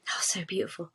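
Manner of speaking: soft, whispered tenderness; almost whisper it, moved by the memory; hushed awe. Real, not acted. Charity worker recalling a child asleep at the sound bath, hushed awe.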